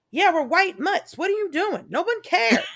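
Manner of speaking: loud, high, scoffing buddy. Retold or imitated. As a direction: brush it off loudly like a careless friend.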